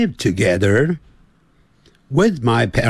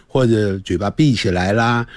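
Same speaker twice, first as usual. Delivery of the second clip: ordinary delivery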